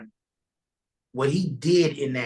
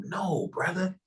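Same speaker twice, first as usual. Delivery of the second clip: lightly voiced minister; 4 semitones higher than usual. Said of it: "He said".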